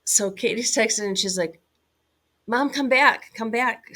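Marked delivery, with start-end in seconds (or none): 2.5-3.9 higher, louder pleading cry; frantic daughter. Retold or imitated. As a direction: urgently call mom back, scared. Frightened daughter texting mom.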